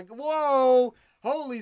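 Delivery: high, loud astonished whoa; real amazement; surprised whoa. Real, not acted. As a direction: gasp it out at the big donation. Huge super chat arrives.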